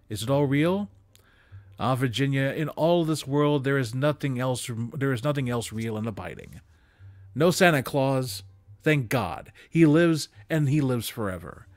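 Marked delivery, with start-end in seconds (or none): none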